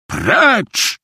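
sharp, high-pitched shout; angry official's outburst; yelled dismissal. A narrator's reading. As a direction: throw him out with one high yell. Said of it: "wrzasnął na Szwajka pan".

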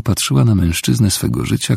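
plain reading voice

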